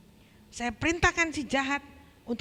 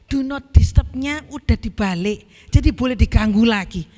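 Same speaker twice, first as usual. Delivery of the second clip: loud mock devil; 11 dB louder than usual. Preacher voicing devil, loud.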